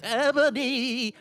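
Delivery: slow, drawn-out villain yell; Voldemort. A narrator's reading. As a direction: call out in a hammy villain voice. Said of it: "he yelled".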